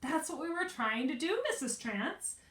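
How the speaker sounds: high, loud, falsely sweet reply; sugary innocence, teasing the neighbor; sweetly. A narrator's reading. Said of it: "Bean said sweetly".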